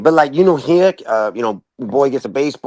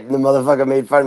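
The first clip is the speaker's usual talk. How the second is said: ordinary talk, nothing marked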